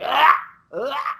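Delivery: two sharp high exhaled yells; short "ah" bursts, as if hitting pads; imitated striking yells. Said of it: "by yelling like that".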